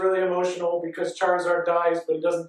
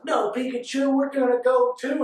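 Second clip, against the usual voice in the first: higher-pitched plucky hero retold; Ash; 4 semitones higher than usual. Boy trainer to his Pokemon, higher determined cry. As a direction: lift the voice for a mock anime hero. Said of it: "and Ash is like".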